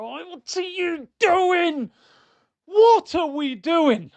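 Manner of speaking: slow, high, drawn-out despair; yelling slowly at the screen; exasperated. Real, not acted. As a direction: stretch out each word in real disbelief. Exasperated at player's mistake.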